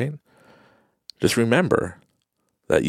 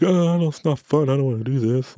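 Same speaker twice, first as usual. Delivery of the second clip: higher, whining mock complaint; mock whiny quitter; 7 semitones higher than usual. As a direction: sulk like someone giving up. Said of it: "And you're like".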